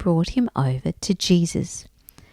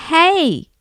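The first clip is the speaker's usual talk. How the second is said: higher-pitched hail to passers-by; calling out; 7 semitones higher than usual. A narrator's reading. Blind Bart hails the passing crowd, calling out. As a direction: call out for attention. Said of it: "he called out".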